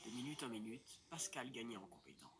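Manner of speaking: low, dramatic narrator voice; story narration. A narrator's reading. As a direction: tell it like a documentary voiceover.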